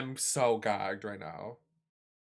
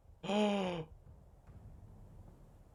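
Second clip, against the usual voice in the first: sharp gasp in shock; 7 semitones higher, 9 dB quieter than usual. Real, not acted. Shocked reaction.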